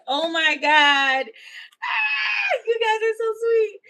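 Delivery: high, loud, near tears with joy; real happy squeal, voice cracking with emotion; overwhelmed shout. Real, not acted. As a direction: cry out, touched and overwhelmed. Viewers praise her, she's moved.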